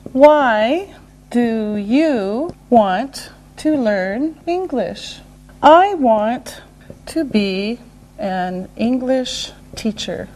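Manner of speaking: one careful word at a time; slow right down, separating the words; slow and deliberate. Shown as a demonstration. Teacher modelling a reason question, slow and separated.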